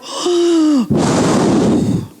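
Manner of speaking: a startled gasp at the news; sharp gasp. Retold or imitated. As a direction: gasp in amazement. Reacting to the wedding story.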